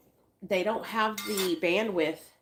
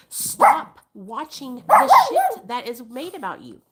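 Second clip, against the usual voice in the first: louder and higher, telling her off; exasperated yell; 6 semitones higher, 9 dB louder than usual. Real, not acted. Vlogger yelling at influencer to stop watching, exasperated. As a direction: snap it at her, fed up.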